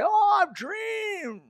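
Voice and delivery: loud, high, drawn-out mock proclamation; mock false prophet. Preacher mimicking false prophets claiming dreams, mock proclamation. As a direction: wail it out like a showy dreamer. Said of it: "they prophesy lies and say".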